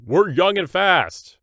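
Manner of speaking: high, loud and slow fan yell; hammed-up triumphant shout; mock scream. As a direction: belt it like a cocky fan after a win. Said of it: "You scream".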